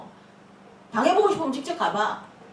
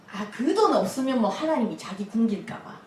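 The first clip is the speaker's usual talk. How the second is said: usual speaking voice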